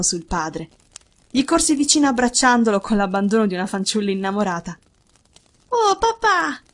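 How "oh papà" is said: high, youthful exclamation; boy's delighted high greeting; joyful cry. A narrator's reading. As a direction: cry out to a beloved father. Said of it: "gridò".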